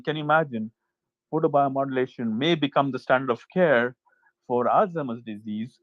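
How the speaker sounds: ordinary delivery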